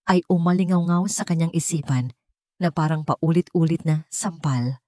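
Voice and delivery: ordinary delivery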